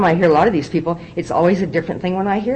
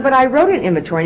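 "wrote" pushed up high and louder, protesting; indignant insistence; 9 semitones higher, 4 dB louder than usual. Retold or imitated. Arguing with her sponsor again, indignant and high. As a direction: push back like a stubborn newcomer.

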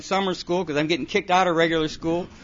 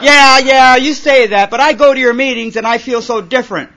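much louder, higher, defensive whine; whiny teenager; 5 semitones higher, 9 dB louder than usual. Retold or imitated. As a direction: complain like a sulky kid. Kid complaining to sponsor.

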